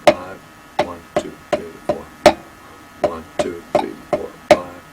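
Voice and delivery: soft counting under the drums, fading to a whisper; counting along. Shown as a demonstration. Drum teacher counting the five-stroke roll for the student, softly fading to a whisper. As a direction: count the beats quietly as you play. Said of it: "So a five would be".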